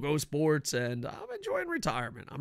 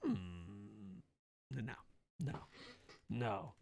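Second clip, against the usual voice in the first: low, hushed hmm then slow noes; drawn-out low musing no; mulling it over; 5 semitones lower, 12 dB quieter, much slower than usual. Retold or imitated. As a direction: talk yourself out of it, quietly. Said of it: "I was like".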